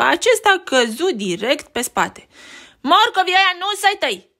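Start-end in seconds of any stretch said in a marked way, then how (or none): 2.8-4.3 high, fast, loud bully yell; nasty rabbit shrieking at Beni. Acted. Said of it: "țipă el".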